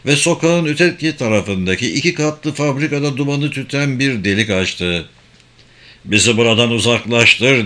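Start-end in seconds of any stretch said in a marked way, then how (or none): none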